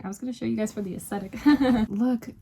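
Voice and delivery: ordinary delivery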